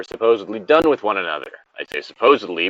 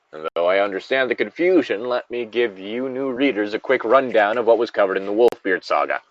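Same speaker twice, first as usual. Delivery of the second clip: ordinary talk, nothing marked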